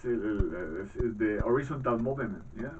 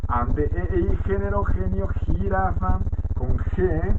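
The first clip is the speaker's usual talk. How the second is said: louder, deliberate word-by-word read-out; dictation-style list reading; 3 semitones higher, 5 dB louder, slower than usual. Acted. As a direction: read out each letter and example like a teacher dictating. Reads letters and example words aloud. (lectura de lista en voz alta, como dictado)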